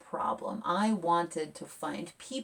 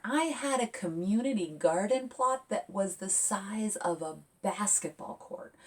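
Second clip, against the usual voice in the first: usual speaking voice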